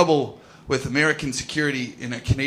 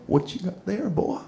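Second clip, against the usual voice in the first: American security guard; 5 semitones higher, 5 dB quieter than usual. Retold or imitated. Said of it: "American security lean over and go".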